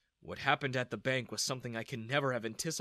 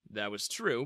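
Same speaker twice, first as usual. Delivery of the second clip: ordinary delivery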